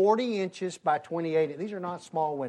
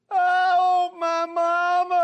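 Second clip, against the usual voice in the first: put-on high wailing cry of a scared boy; 10 dB louder, much slower than usual. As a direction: wail it high and loud like a crying kid. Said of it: "something like this going on".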